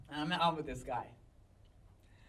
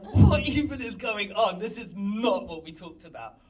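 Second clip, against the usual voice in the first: exasperated outburst; 4 semitones higher, 9 dB louder than usual. Retold or imitated. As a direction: protest in baffled exasperation. Retelling baffled reaction to dingy place.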